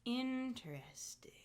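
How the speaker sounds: whisper. Real, not acted. Quiet musing reply.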